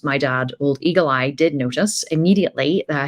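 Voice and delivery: ordinary delivery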